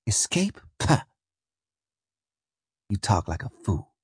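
soft, scornful dismissal; sneering. Acted. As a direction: scoff quietly at the very idea. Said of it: "Her lips writhed in a sneer".

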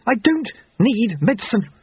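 high-pitched, agitated shout. A narrator's reading. Jeremy refuses his doctor's medicine, shouted high. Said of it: "Jeremy shouted".

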